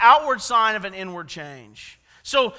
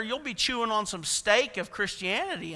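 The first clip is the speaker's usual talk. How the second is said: ordinary talk, nothing marked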